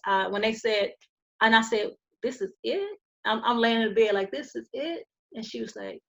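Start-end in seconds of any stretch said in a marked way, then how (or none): 2.2-3.0 weak quiet question. Retold or imitated. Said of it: "this is exactly how I'm talking". 4.3-5.1 faint, breathless rising question; barely voiced disbelief from a hospital bed; weak quiet question. Patient in hospital bed asks the nurse, faint and quiet. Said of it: "this is exactly how I'm talking".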